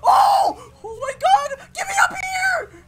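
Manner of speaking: very high, loud game panic; frantic high-pitched yelling; panicked shouting. Pretend. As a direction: shriek to be let up, in a frenzy. Panicked climbing away in game.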